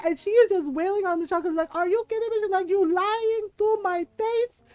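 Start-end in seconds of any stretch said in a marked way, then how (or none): 1.8-2.4 high accented imitation of maternal outrage; mock angry mom. Mom wailing on her son, high mock-angry scolding. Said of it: "she's just yelling at him". 2.7-4.6 put-on high, slow, accented accusation; mock angry mom. Mom confronting the tackled son, slow high mock-angry accusation. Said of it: "she's just yelling at him".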